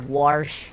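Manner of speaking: "Warsh" as Trump says it. Retold or imitated. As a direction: put an R into "Warsh". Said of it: "but he puts an R in there".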